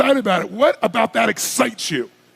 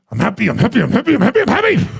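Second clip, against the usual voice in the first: hammed-up self-convincing chant, rising and louder at the end; forced fake cheer; 3 dB louder, faster than usual. Mocking someone trying to think themselves happy, forced chant. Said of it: "if you ever try to do it with your head".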